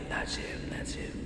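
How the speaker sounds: soft whisper. Acted. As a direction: whisper it secretly. Whispered line.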